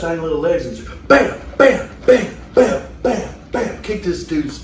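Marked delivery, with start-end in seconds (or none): 0.7-3.8 loud, high bam bam impact noises; imitating kicks to the head; punch sounds. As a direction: pound out each bam. Retelling a beating, sound effects.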